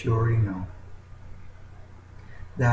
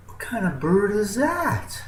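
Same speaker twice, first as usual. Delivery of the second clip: higher, wide-eyed question; eagle asking in wonder; 9 semitones higher than usual. Acted. Eagle asks his chicken friend, higher and amazed. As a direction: ask it like an amazed young bird. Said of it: "and he goes".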